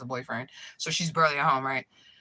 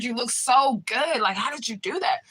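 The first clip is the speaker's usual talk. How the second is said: higher, drawn-out imitated admiration; infatuated gushing; 6 semitones higher than usual. Smitten man asks about her hair, infatuated gushing. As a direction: play the smitten admirer, voice lifted. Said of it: "He was, like, infatuated".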